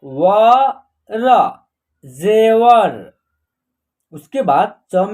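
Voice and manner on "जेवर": जेवर very slow and a bit louder; जेवर stretched, each syllable held; slow word blending. Shown as a demonstration. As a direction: draw out जेवर for students. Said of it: "ज में ए की मात्रा जे".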